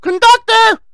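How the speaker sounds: very high, loud mock squeal; piercingly high mocking cry for help; voice turned whiny wimp. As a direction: mimic a tough guy turning into a shrieking coward. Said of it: "Then his voice changed".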